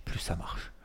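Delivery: breathy unvoiced drop at the end of the thought; hushed, nearly voiceless close; whispered aside. Real, not acted. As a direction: let it fall to a whisper. Trailing off on his point.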